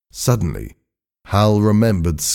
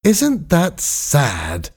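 very slow, high mock sympathy; sarcastic; 8 semitones higher, much slower than usual. A narrator's reading. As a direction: draw it out in fake pity. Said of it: "said Skink sarcastically".

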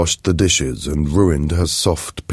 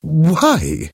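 high, sharp surprised why; puzzled and taken aback; 11 semitones higher than usual. Acted. Asking Mathilde why, high startled question.

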